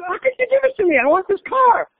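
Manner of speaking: imitating a young self begging for the car; whiny teenage son. Son protests as father walks out.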